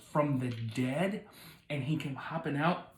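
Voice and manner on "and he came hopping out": ordinary delivery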